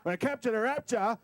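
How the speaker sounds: loud, high ring-announcer call; boxing promoter. Pretend. Opening a mock fight for the audience, boxing promoter. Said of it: "It's my boxing promoter moment".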